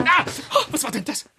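sudden pain and surprise when sitting down; startled yelp. Acted. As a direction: jump with a cry of surprise. Father sits on hornet, stung.

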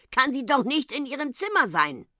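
put-on high, nagging old woman voice; quarrelsome. A narrator's reading. Old woman arguing by phone with uncle, quarrelsome. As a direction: argue the point querulously. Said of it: "sagte das Weib zänkisch".

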